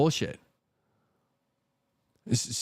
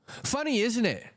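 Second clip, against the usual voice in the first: sarcastic; 10 semitones higher than usual. Acted. Mocking the missing short ETFs.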